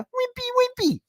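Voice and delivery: wimpy, wimpy squeaked very high; squeaky wimpy voice. Pretend. Host mocking weak fans, squeaky and high. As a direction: squeak it like a tiny weakling.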